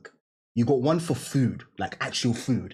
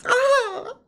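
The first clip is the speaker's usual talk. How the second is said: high squealing cry of delight; ecstatic cry. Real, not acted. Remembering favorite snacks, ecstatic high squeal. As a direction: squeal like the taste is overwhelming.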